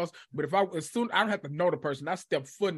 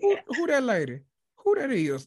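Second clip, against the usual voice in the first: much higher, startled who's there; stunned, pitched-up question; shocked disbelief; 7 semitones higher than usual. Retold or imitated. Shocked at unrecognizable corpse.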